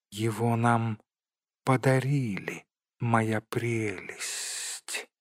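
soft, slow creature voice; doting Gollum. A narrator's reading. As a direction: draw out the last words tenderly. Narrator plays Gollum. (тихий протяжный голос Голлума)

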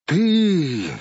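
drawn-out, indignant cry; outraged cry. A narrator's reading. Dwarf seizes the hobbit who betrayed him, outraged. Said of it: "возопил Торин".

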